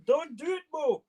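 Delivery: high squeaky mock protest voice; mock shouting critics. Viewers begging him not to add sauce, mock squeal. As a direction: squeal it like a horrified purist. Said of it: "some people are gonna be shouting".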